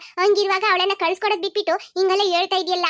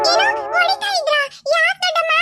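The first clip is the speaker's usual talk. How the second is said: shocked; 8 semitones higher than usual. Acted. Child hears her friend was beaten, shocked high cry. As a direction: gasp it out in disbelief.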